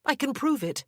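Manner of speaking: a hint of a firm young mother; Anna, defiant. Anna answers her captor.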